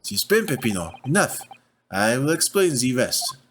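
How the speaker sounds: stern French nobleman; French accent. Acted. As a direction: scold your partner in a French accent.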